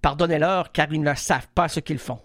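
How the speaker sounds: low, solemn biblical delivery; grave mock-sacred voice; mock Jesus line. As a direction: intone it like a preacher quoting scripture. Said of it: "Ok, alors vas-y".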